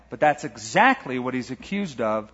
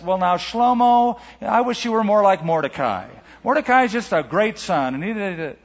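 higher, lightly mocked comparing parent; 5 semitones higher, 4 dB louder than usual. Father to son Shlomo about Mordecai, lightly mocked nagging. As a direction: lightly voice a parent holding up the favourite, pitched up.

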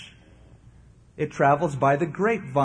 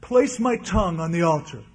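lightly imitated pious churchwoman, pitched higher; overly devout, insincere vow in a raised voice; mock piety; 5 semitones higher than usual. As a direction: put on a show of holiness. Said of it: "she said real piously".

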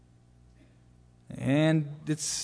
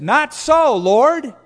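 much higher and louder protest; shocked refusal; 10 semitones higher, 9 dB louder, much slower than usual. Acted. Peter refusing God in the vision, loud shocked protest. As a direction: recoil and cry out your refusal.

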